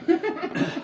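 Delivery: pitched far up on the last word; squeaky high ending; high squeak. Acted. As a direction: squeak the end out. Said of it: "he says".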